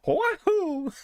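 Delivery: high-pitched mock Italian whoop; bad Italian accent. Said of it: "put on a shit Italian accent".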